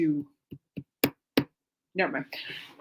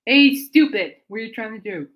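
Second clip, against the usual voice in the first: mock scold; 3 semitones higher, 8 dB louder than usual.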